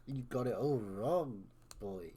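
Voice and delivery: low, smug West Country villain. Acted. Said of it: "doing a villain voice in West Country".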